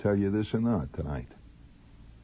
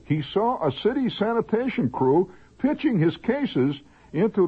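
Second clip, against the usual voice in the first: singsong report, climbing higher as it goes; theatrical news read; 7 semitones higher than usual. Acted. Host reading a note from Boston, increasingly melodramatic news read. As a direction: read the item like a melodrama, building.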